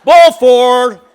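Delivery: loud umpire call. Retold or imitated. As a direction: announce it like the umpire.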